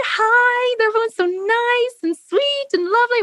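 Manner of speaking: very high, sing-song sweetness; sugary American. Retold or imitated. Mocking American friendliness, high sugary gushing. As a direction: gush with overdone niceness. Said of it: "everything is like".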